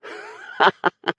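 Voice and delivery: airless giggle of disbelief; high breathy laugh. Acted. Langley cracks up after the fight, breathless. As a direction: burst out laughing.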